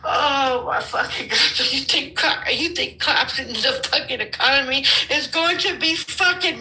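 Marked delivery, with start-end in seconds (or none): none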